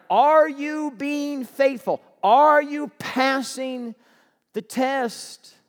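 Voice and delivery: loud, high, slow challenge; preacher pressing the congregation on faithfulness; preaching challenge. Real, not acted. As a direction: drive the question home slowly.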